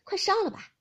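high, loud, hurried command; pressing, high-pitched instruction; urgent order. A narrator's reading.

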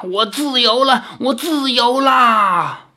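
a demon king crowing he is finally free; exultant demon. Acted. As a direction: savour each word of freedom, high and drawn out.

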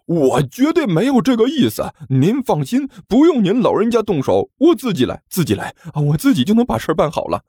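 high, fawning thug voice; eager cowardly thug. Acted. Thug wheedling the heroine, high fawning voice. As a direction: beg to be robbed, wheedling.

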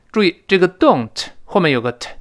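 ordinary talk, nothing marked

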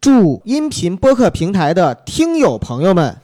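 slow, loud gala host greeting; cheerful new year wishes. Pretend. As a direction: beam out holiday wishes to the audience. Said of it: "我以为你说咱模仿的这个开场呢".

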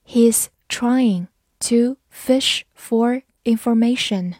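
word by word, with clear gaps; slow reading. Shown as a demonstration. English teacher models the sentence for learners, slow reading. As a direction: read it slowly for learners, each word separate. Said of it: "慢慢来读".

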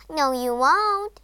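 loud, drawn-out taunt; defiant frog. Acted. Frog defies the princess.

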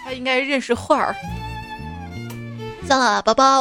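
ordinary delivery

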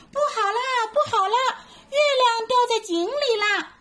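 high, panicky little monkey yelling; panicked cry. Acted. Little monkey sees the moon in the well, high panicked cry. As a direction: cry out in alarm, running. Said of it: "边跑边大声叫".

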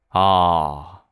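slow, dawning reveal of 大气; slow punchline reveal; slow realization. Shown as a demonstration. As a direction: savour the punchline 大气 slowly. Realizes tattoo reads 大气.

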